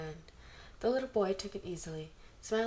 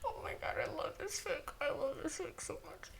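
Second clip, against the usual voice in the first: high, breathless giggly gushing; 9 semitones higher, 4 dB quieter than usual. Real, not acted. Reader gushing over the fic between laughs, high and giggly. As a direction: squeal through the laughter.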